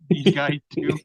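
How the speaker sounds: loud, slowed by laughter; laughing. Real, not acted. Storyteller amused by his own smugglers' haul, laughing. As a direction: laugh through the words. Laughs while adding a joking remark.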